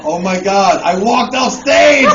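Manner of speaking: loud, high shout of mock horror; horrified realization. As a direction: shout it as the awful truth hits. Sudden horrified realization acted out.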